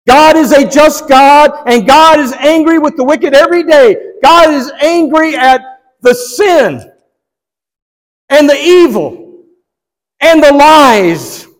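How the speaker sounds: high, ringing pulpit declaration; forceful preaching. Real, not acted. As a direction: proclaim it with rising force, phrase by phrase. Preaching on God's anger.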